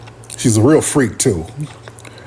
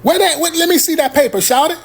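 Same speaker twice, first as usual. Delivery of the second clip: Atlanta local voice. Retold or imitated. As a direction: local asking to see the paper. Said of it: "learn Atlanta slang on the fly".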